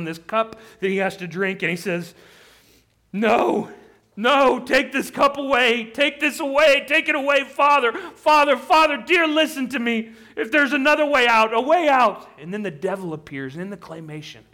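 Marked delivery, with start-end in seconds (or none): 3.1-12.3 loud, high, urgent begging; anguished cry to a father. Acted. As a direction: plead desperately to be spared, voice raised. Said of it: "It's Jesus crying out".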